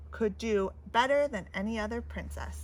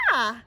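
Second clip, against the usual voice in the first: startled cry; 6 dB louder than usual. Acted. Another pinch.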